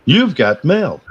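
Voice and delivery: chirpy computer announcer; AOL voice. Pretend. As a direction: mimic the old email alert.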